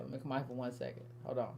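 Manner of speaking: said from across the room, fading out; distant and faint, off-mic; far off. Real, not acted.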